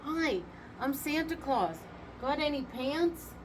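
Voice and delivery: high, cheery call to passersby. A narrator's reading. Santa calling to busy pedestrians, high and cheery. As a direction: call out to the street. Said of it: "calling out to busy pedestrians".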